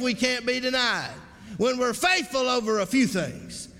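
rhythmic, rising pulpit declarations; preaching refrain. Real, not acted. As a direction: drive each promise home to the congregation.

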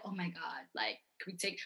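imagining meeting a star, hushed fangirl gush; hushed starstruck. Retold or imitated. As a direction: murmur it, awed and shy. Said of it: "I would just be like".

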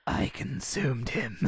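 slow menacing whisper; creepy slow confession in a whisper; sinister whisper. Acted. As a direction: whisper it slowly with dark relish.

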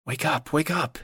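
hushed nudge to wake someone; urgent hushed waking. A narrator's reading. Nudging sleeper awake, hushed.

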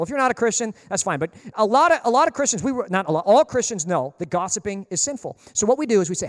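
ordinary talk

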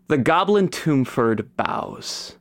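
ordinary talk

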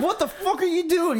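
startled shout. Retold or imitated. Friend retelling waking to Casey, startled high yelp. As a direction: jolt awake and yelp at him. Stranger climbing into his bed.

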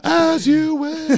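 very high, slow and drawn out; Princess Bride voice. Acted. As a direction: breathe it like a swooning hero. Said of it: "some Princess Bride stuff".

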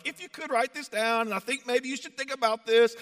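high, meek mocking voice; mock a wishy-washy, tentative suggester; put-on high voice, politely hedging; passive, timid. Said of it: "Paul's not some passive".

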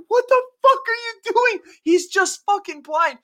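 high exasperated yell; incredulous yell. Retold or imitated. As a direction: shout in disbelief. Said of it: "I was like".

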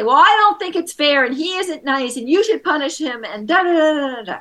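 a lightly voiced upset boy complaining to a parent; complaining kid. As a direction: play the aggrieved son tattling on his brother. Parent voicing angry son Johnny.

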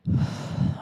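real long breathy sigh; gasping for breath. Real, not acted. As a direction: heave for a deep breath. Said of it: "Oh, I gotta breathe".

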